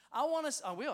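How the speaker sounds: loud, high preaching push; rising fervent sermon call. Acted. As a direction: preach it out with fire. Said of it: "I'm preaching".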